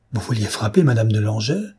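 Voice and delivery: usual speaking voice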